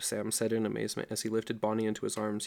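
usual speaking voice

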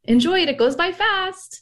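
put-on high wistful older woman voice; stranger's wistful advice. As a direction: gush it at a tired new mother. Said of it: "she would say".

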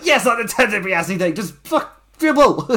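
high, loud, furious yell that rises into a shout; Cameron Grimes yelling. Retold or imitated. Con man yelling at his mark to dribble, loud and high. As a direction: bellow it like a desperate con man losing it. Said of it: "I got into the character too much".

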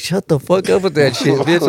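high mock scolding of a friend; laughing scold. Coworker laughing at him after the prank, mock scold. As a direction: scold him while cracking up.

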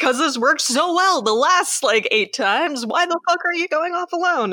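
mock scolding a movie character, pitched high; shouting sarcastically at the screen; exasperated. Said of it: "Because I'm like".

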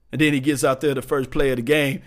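normal talking voice

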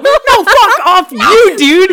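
high, loud, slow outraged yell; insulted shout. Acted. Shopkeeper furious at the tiny payment, shouting.

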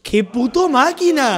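high, drawn-out exclamation of amazement; real admiring exclamation. Real, not acted. Listener amazed by the doctor's kindness, high drawn-out admiration.

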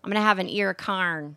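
St. Louis accent with "carn" for corn. Shown as a demonstration. Co-host giving an example sentence, St. Louis accent. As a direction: put the St. Louis AR vowel in "carn". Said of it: "they say carn".

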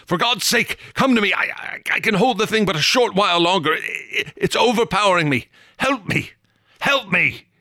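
high, fast, desperate pleading; despairing plea. A narrator's reading. Harry pleads with Hammond while wrestling the creature, despairing plea. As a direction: beg for help as your strength fails. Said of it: "I cried again, despairingly".

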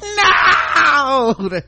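high, long, loud wailing "No"; hammed-up anguished scream; mock scream. Host imagines losing a hand like Luke Skywalker, hammed-up scream. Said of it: "I would have been screaming".

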